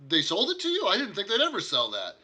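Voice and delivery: high and loud, incredulous; startled townsman voice; surprised NPC. Acted. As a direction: make him gasp at the news. GM voices NPC about horse.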